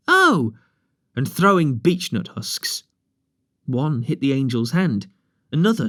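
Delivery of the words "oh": a higher, louder jeering Oh!; crying out. Acted. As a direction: yell it like a pack of boys. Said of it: "They all began crying".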